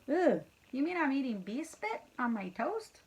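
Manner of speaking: usual speaking voice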